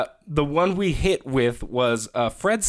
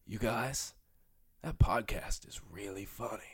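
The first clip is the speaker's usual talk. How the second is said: soft quoted praise; 6 semitones lower, 12 dB quieter, slower than usual. Retold or imitated. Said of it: "she was like".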